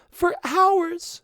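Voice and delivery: very high, tearful little voice; a little crying. A narrator's reading. Stuck sheep telling her rescuer, lightly crying. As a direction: answer while still weepy. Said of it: "still crying a little".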